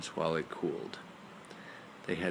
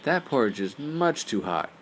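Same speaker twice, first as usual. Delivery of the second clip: put-on complaining girl; 5 semitones higher, 5 dB louder than usual. Goldilocks complaining about hot porridge.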